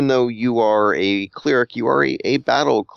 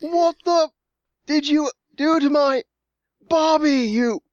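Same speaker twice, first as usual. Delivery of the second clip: high-pitched, raging Australian that drifts British; very British-sounding Australian. Pretend. Player playing a berserking Australian, angry British-sounding accent. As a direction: attempt an angry Aussie, landing very British. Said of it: "That sounds very British".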